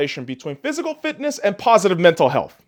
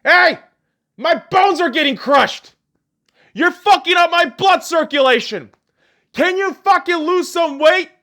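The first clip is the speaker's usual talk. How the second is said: body screaming; 10 semitones higher than usual. Pretend. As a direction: yell like a crushed body begging for mercy. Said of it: "your body screaming at you, saying".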